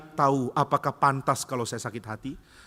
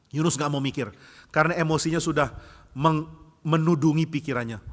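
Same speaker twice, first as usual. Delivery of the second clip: usual speaking voice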